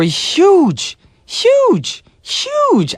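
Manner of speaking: huge, climbing into a squeal; rising squeal on huge. Acted. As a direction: stretch huge then squeal it twice. Radio host hyping the group.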